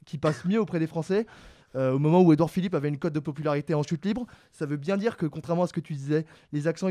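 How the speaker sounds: ordinary delivery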